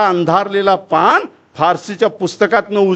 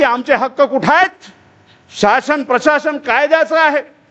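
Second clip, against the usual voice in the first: high, sustained oratorical protest; impassioned demand; 9 semitones higher than usual. Acted. Phule petitioning the British for rights, impassioned high demand. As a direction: declaim it high, stretching the final question.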